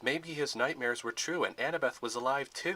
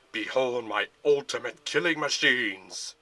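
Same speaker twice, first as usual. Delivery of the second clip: slow, louder grand boast; grand proclamation; 6 dB louder, slower than usual. A narrator's reading. General to Luke, a slow grand boast. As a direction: unveil it like a showman.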